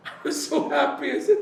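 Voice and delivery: high, loud, laughing delight; joyful laugh; laughing happily. Real, not acted. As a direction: burst out laughing with relief. Recalls joy of going home.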